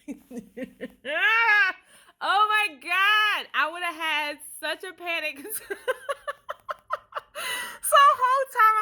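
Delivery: can barely get it out for laughing. Real, not acted. Laughing at near wig mishap.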